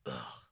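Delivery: low disgusted ugh; acted exasperated grunt; disgusted groan. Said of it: "Jonah is furious at God's grace".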